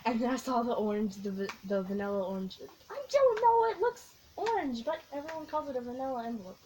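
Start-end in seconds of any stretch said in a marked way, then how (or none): 2.9-6.6 high and loud, exasperated; exasperated outburst. Real, not acted. Vlogger fussing over the envelope color, exasperated outburst. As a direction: blurt it out, fed up with the name.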